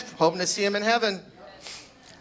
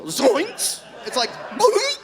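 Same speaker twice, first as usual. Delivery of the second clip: squeaky cartoon yelp, much higher; Scooby-Doo yelp; 12 semitones higher, much slower than usual. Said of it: "Scooby-Doo".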